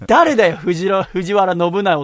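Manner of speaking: loud, baffled outburst; a loud, baffled outcry; exasperated. Real, not acted. Exasperated reaction to email.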